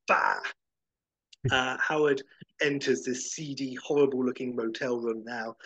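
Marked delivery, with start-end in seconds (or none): 0.1-0.4 very low, loud disgusted bah; soaked duck grunt. Retold or imitated. Howard splashed by the bus, a very low, loud disgusted bah. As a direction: spit it out after the splash. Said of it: "And Howard's".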